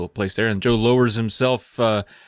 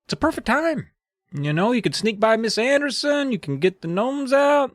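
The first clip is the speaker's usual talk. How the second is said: high gnome voice; 11 semitones higher than usual. Acted. Gnome persuading.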